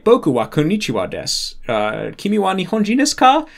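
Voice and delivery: Japanese in a thick American accent, pitch swooping up; super bad American accent. Shown as a demonstration. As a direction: mangle the Japanese vowels with American English ones. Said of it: "like, a super bad American accent".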